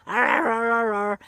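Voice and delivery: imitating the puppy's chatty growl; puppy's talking growl. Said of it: "his talk sounds like a growl".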